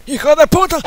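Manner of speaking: a high, loud curse; angry yell. Acted. Old grandpa getting killed in the game, yelling a curse. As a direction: yell the insult when killed.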